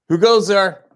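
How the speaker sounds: playful loud call; mock sentry challenge. Playful challenge to approaching child.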